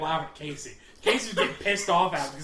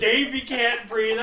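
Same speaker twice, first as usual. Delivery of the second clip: usual speaking voice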